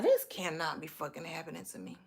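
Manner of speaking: very soft mock disbelief; hushed dismay. Woman telling herself this date cannot be happening, hushed dismay. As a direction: say it under your breath, appalled. Retold dismay at date's revelation.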